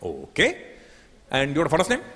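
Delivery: mock Indian call centre agent, insistent; Indian accent. Agent insists on his question in an Indian accent.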